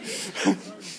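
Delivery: short, airy laugh; real chuckle. Real, not acted. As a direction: let the amusement trail out in a laugh. Amused amazement at student's answer.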